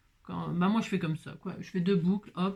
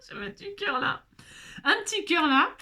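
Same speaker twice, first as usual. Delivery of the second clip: high, cutesy little voice; 9 semitones higher than usual. Real, not acted. (voix toute mignonne)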